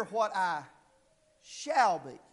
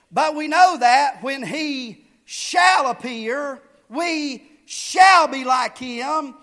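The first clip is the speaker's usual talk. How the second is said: slow scripture reading, "shall" punched louder each time; stress on shall; 6 dB louder, slower than usual. Shown as a demonstration. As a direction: lean hard on every "shall", drawn out. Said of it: "I'm gonna emphasize the word".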